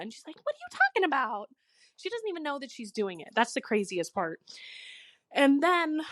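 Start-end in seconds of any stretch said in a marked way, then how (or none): 0.4-1.5 quick, high-pitched imitation of a baffled mom; mock oblivious protest. Mom answering her daughter's scolding, mock oblivious protest. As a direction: play mom with no idea what she did. Mom's oblivious retort, acted.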